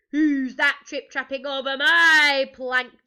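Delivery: loud, slow, drawn-out jeer; big mocking pirate challenge. A narrator's reading. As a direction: taunt him with stretched words. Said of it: "jeered Hank Chief".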